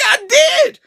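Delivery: a mock whiny insistence; whiny protest. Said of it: "And I'm like".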